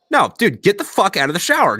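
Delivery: insist, pitch pushed up; insistent demand. Retold or imitated. Arguing with his buddy over the shower, insistent demand.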